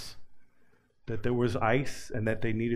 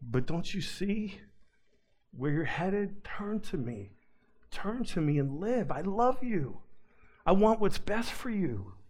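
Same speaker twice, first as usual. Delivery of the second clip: loving plea; 5 semitones higher than usual. Acted. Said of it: "God is just lovingly there saying".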